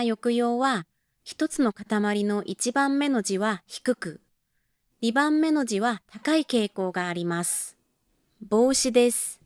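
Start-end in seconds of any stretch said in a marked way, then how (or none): none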